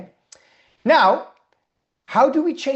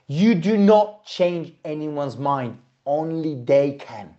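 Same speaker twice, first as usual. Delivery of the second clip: firm slow teaching point; slow and deliberate; 4 semitones lower, slower than usual. Shown as a demonstration. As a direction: spell it out slowly. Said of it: "teacher stresses key point slowly".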